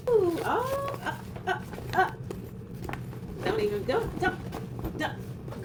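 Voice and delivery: high gasps fading into breathless pleading; overwhelmed gasp. Real, not acted. Opening the last gift, breathless overwhelmed gasps. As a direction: gasp and fade to an airy plea.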